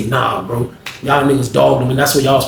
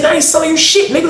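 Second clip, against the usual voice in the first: repeated angry refusal, pitched way up; 14 semitones higher, faster than usual. Retold or imitated. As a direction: say it again, high and hard. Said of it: "I said".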